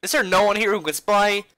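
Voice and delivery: high, loud call for help; loud searching call. Acted. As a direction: shout it out across the room. Voicing game character calling out.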